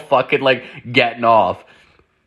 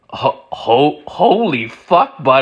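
stammering shock; 2 semitones higher, 3 dB louder, slower than usual. Retold or imitated. Hearing she divorced her millionaire husband, stammering shock. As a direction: trip over the words in disbelief.

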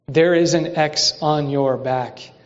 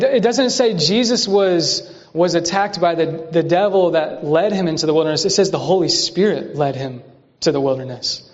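usual speaking voice

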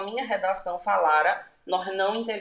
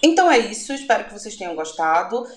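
ordinary talk

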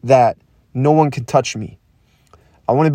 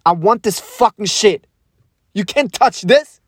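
high-pitched, fired-up self-pep talk; exhilarated, daring declaration; defiant hype; 7 semitones higher than usual. Real, not acted. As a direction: psych yourself up, pitch climbing. Psyching himself up, defiant.

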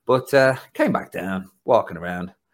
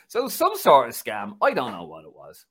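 ordinary talk

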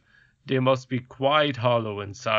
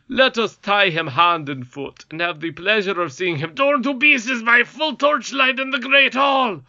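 rising, louder gleeful king; gloating king; 9 semitones higher, 5 dB louder than usual. Acted. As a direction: build to a cruel king's boast. King voiced by reader.

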